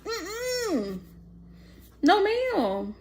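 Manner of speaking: high disapproving mm-mm, no ma'am; firm no. Real, not acted. Speaker refusing the idea, sassy disapproval. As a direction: shut it down with sass. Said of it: "disapproving refusal".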